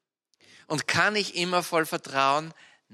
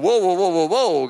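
loud, amazed wows; 7 dB louder than usual. Retold or imitated. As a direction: gasp out the wows in awe. Amazed in speeding car dream.

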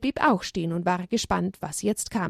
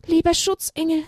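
higher lamb voice calling to the angel; pleading lamb; 9 semitones higher, 3 dB louder than usual. Acted. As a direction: call to the guardian angel from the heart. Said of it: "rief Haberle laut mit seinem Herzen". (Lämmchen ruft flehend, höhere Stimme)

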